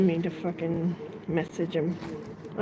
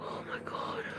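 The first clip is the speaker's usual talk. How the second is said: scared whisper; 11 dB quieter than usual. Real, not acted.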